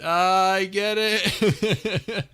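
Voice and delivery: very slow, high, loud laughing. Real, not acted. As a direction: draw it out while laughing. Groaning at the pun.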